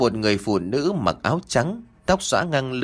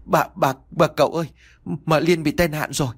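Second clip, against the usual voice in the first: higher-pitched, flustered servant; panicked stammering; 5 semitones higher than usual. A narrator's reading. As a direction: stammer out bad news in a panic. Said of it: "hốt hoảng nói lắp ba lắp bắp".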